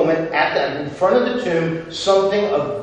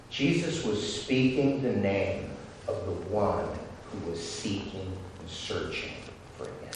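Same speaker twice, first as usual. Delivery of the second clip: much softer, drawn in close; intimate hush; 8 semitones lower, 13 dB quieter than usual. Acted. Preacher quietly pressing the point home, soft and intimate. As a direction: draw them in with a near hush.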